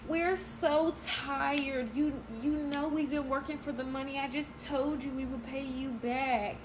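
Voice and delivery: high, drawn-out whining imitation; imitating a crying friend; mock whiny excuse. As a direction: mimic her tearful excuse, slowly.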